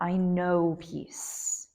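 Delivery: very slow, firm statement; confident, emphatic. Shown as a demonstration. Teacher demonstrating a sankalpa, calm and emphatic. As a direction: give "I am peace" slowly and with full conviction. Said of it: "confident, emphatic".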